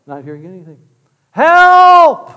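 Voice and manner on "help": high, full-volume cry for help; yelling for help. Acted. Man hanging from a branch calls for rescue, yelling. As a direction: holler it out into the canyon. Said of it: "he's yelling".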